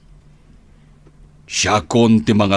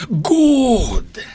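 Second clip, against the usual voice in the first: delighted shout; 7 dB louder than usual. Acted. Father pleased with son.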